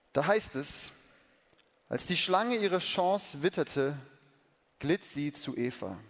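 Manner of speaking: slow, hushed storybook narration; story reading. Acted. Preacher reading to church, hushed storytelling. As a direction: read the Bible story gently to the congregation. Reads children's bible story aloud.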